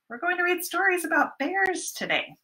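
high, fast and eager; excited announcement. Real, not acted. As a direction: announce it with a burst of delight.

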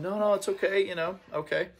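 lightly imitating a polite decline; a hint of a shy man waving it off; polite refusal. Man politely refusing a second hoodie.

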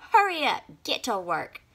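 high, loud impatient snap; snapping. A narrator's reading. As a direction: hurry them along angrily. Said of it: "he snapped".

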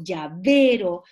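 "llavero" slow and loud, weight on VE; stressed syllable shown. Shown as a demonstration. Teacher giving examples of tonic syllables, stressed syllable shown. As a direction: lean hard on the VE of "llavero", drawn out. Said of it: "donde recae la voz".

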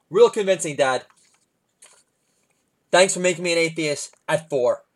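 lightly voiced sarcastic little girl; sarcastic kid daughter. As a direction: play the unimpressed four-year-old.

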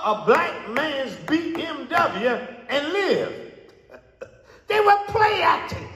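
high, insistent preacher's cry; full-force preaching. Real, not acted.

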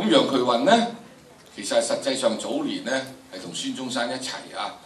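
ordinary talk, nothing marked

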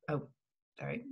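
ordinary delivery, nothing marked

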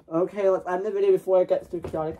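very low, rushed character voice; deep dopey voice, hurrying; deep fast cartoon voice. Deep character ends video.

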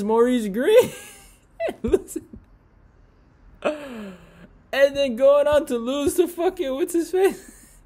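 high and loud, laughter spilling through; can't stop laughing while telling it; laughing hard. Real, not acted. Mocking the fighters' losses through high laughter.

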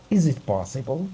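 plain reading voice

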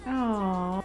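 ordinary talk

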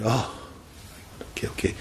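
ordinary delivery

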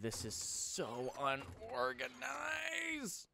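high, drawn-out wail of frustration; exasperated whine. Real, not acted. Exasperated at the messy storage.

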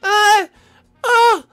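high, loud, wailing excitement; hammed-up tearful squeal; overwhelmed new parent. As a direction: cry out in mock joy.

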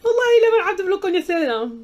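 high, loud laugh-cry through the words. Real, not acted. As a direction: laugh so hard it nearly turns to tears. Joking she'll be blocked too.

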